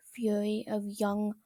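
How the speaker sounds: usual speaking voice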